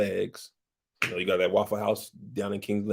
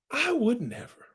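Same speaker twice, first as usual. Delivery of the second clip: "I would never" in a high, offended voice; prim victim denial. Acted. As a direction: deny it like a scandalized lady. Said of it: "Rhaenyra stuck to her story".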